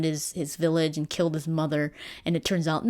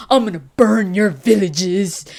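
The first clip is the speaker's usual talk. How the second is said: villain threat; 3 semitones higher, 10 dB louder than usual. Retold or imitated. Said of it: "he's like".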